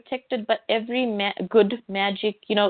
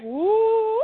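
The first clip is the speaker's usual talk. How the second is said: excited woo; 12 semitones higher than usual. Retold or imitated. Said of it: "I'm like".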